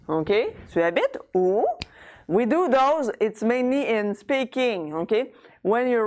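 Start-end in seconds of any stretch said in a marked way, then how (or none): 0.7-1.6 spoken question, pitch climbing on "où"; rising intonation. Shown as a demonstration. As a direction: run together with "où" rising. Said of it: "if I'm doing rising intonation".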